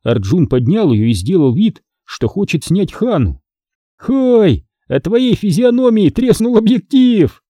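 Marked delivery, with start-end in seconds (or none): 4.0-7.4 high, drawn-up mocking cry; teasing exclamation. A narrator's reading. Boy pretending to photograph Hannah, exclaiming. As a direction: exclaim it high, making fun of her. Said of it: "воскликнул он".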